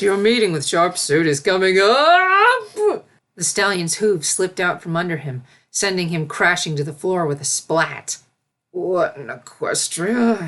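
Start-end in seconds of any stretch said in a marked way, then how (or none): 0.0-3.0 loud, slow urgent call; urgent announcement. A narrator's reading. Servant bursting in announcing. 8.7-10.5 groaning. A narrator's reading. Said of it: "he groaned".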